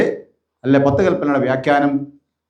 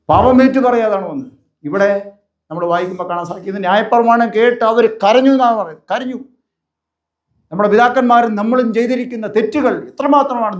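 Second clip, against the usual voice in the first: raised, fervent pulpit voice, hammering each line; preaching at full force; 7 semitones higher than usual. Acted. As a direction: drive the repeated warnings home to the congregation. (ആവേശത്തോടെയുള്ള പ്രസംഗം)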